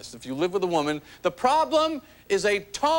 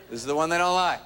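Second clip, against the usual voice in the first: lower male voice; 7 semitones lower, faster than usual. Shown as a demonstration. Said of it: "The male voice starts to come down here".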